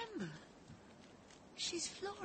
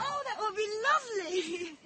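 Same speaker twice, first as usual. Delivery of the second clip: higher, bright delighted gush; 11 semitones higher, 3 dB louder than usual. Acted. Mother accepting Gerard's dinner invite, delighted.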